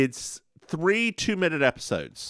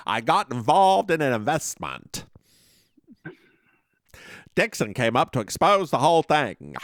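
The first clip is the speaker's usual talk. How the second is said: put-on hokey Western accent. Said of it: "Was that accent?".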